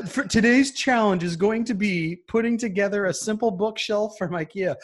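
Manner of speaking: lightly hammed game-show host; mock host announcement.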